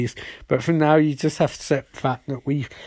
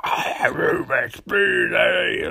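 slow, hammed-up villain boast; slow, strange mock villain roar; 3 semitones higher, slower than usual. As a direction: drag it out like a cartoon baddie. Eobard bursts out, eyes red.

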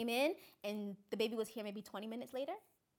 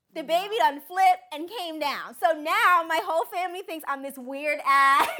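much louder and higher, slow, savoring the payoff; excited punchline; 6 semitones higher, 12 dB louder, slower than usual. Real, not acted. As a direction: land the twist big and drawn out. Punchline of her miracle story.

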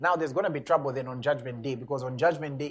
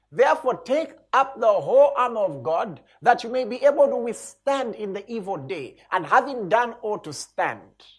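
preaching at full force; 5 semitones higher, 6 dB louder than usual. Acted. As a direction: proclaim each line to the congregation. Reads armor of God passage.

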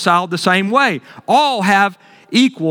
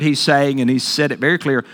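ordinary talk

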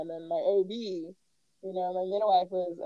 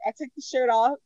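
ordinary delivery

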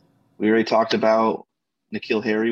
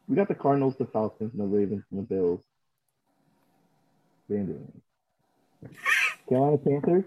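muffled bad mic; underwater. Real, not acted. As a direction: sounds like under water. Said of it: "You sound like you're underwater".

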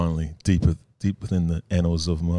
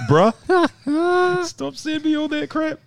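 much higher mock exasperated bruh; mock scolding; 4 dB louder, much slower than usual. Host mock-scolds McSweeney for sending photos, high exasperated. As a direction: pretend outrage, pitched way up.

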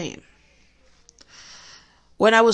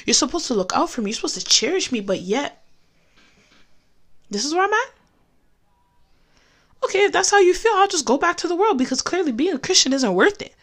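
angry at God; 6 semitones higher, faster than usual. Retold or imitated. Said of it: "I became angry with God".

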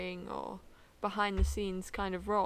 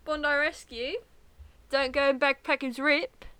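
loud, higher, hammed-up Aussie accent; Australian accent; 4 semitones higher, 8 dB louder than usual. Co-host egged on to do it, hammy Australian accent. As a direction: put on a big, jokey Australian voice. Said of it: "I'll do the Australian accent again".

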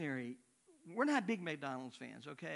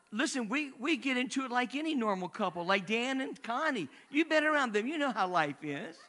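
louder, higher, chuckling through the words; laughing; 7 semitones higher, 9 dB louder than usual. Real, not acted. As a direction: laugh along while admitting couples fight. Laughing about everyday marital quarrels.

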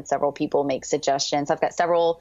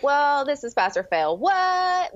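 the "What?" jumps far higher in mock confusion; mock baffled; 9 semitones higher, much slower than usual. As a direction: play your past self's bafflement. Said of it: "I was like".

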